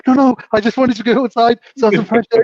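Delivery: high, loud, pleading imitation; mock arguing. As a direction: act out frantic coaxing, amused. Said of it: "they were arguing on the floor".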